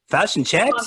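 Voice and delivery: high-pitched attempt at New Zealand vowels in "fish and chops"; mock Kiwi, bending the short "i" in "fish" and "chops"; Kiwi accent. Shown as a demonstration. Contrasting two accents for the hosts, mock Kiwi accent. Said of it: "but if you're a Kiwi".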